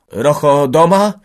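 high, loud, slow question; very fearful. A narrator's reading. Village head asking after Rocho, fearful. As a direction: ask it anxiously, drawn out. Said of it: "pytał wójt wielce zalękniony".

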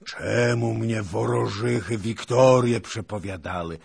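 loud, high wailing roar; raging cry of a beaten commander. A narrator's reading. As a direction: bellow the bitter question in rage. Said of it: "ryczał dalej hetman".

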